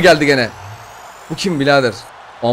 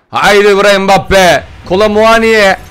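slow, drawn-out yell climbing ever higher; building hype shout; 8 semitones higher, 8 dB louder, much slower than usual. Real, not acted. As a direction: chant the names, rising to a scream. Building hype at pack opening. (giderek yükselen coşkulu haykırış)

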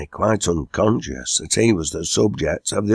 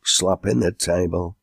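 plain reading voice

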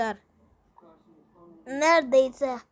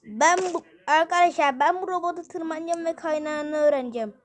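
normal talking voice

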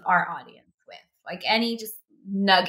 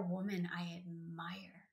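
whispered tribute; 14 dB quieter, slower than usual. Real, not acted. Heartfelt tribute to cousin.

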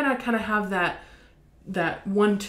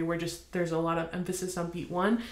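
ordinary delivery

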